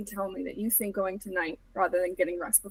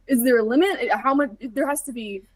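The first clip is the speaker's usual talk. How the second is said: higher, louder worried rush; alarmed questions; 3 semitones higher, 8 dB louder than usual. Acted. Arlo reacting to Silas's bite, alarmed and urgent. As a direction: grasp for hope.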